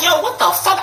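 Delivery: very high-pitched incredulous retort; high mock-stunned reaction; shocked disbelief. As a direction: retell your shock in a high voice. Retelling own shocked reaction.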